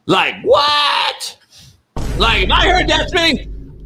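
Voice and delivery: high shocked shout; shocked outcry. Real, not acted. Shocked reaction.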